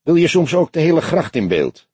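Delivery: high-pitched, exasperated sarcastic question; grumpy. A narrator's reading. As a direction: grumble at an unreasonable demand. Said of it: "vroeg Bram van Wielingen knorrig".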